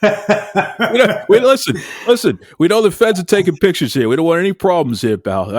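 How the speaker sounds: laughing, hammed-up wiseguy warning; mock mobster. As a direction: play a tough guy while cracking up, fast. Jokingly playing mobster to the guest.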